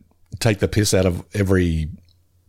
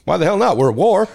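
Jimbo voice; 10 semitones higher than usual. Retold or imitated. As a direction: bark it like a gung-ho vet. Quoting Jimbo's reply.

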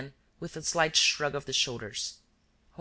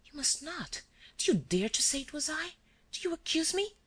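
frightened protest; 12 semitones higher than usual. A narrator's reading. Girl protests being accused.

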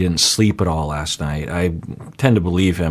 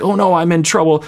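put-on high, whiny child voice with a sigh; self-pitying kid; 13 semitones higher than usual. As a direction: whine like a child who knows he's caught. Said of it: "They're like".

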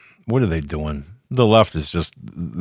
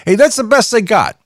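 mock Biden voice; 6 dB louder than usual. Mocking Biden as the left's best.

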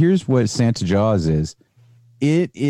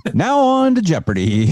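host voice, higher and louder; game show host; 8 semitones higher, 3 dB louder than usual. Pretend. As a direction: announce it like a quiz host.

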